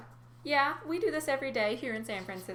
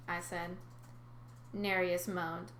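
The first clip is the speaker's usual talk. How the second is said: plain reading voice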